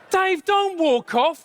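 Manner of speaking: high, slow, urgent call; calling a mate back. Acted. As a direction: call after a friend who's leaving. Diner calls his mate back.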